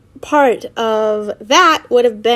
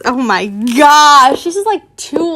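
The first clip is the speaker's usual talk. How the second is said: plain reading voice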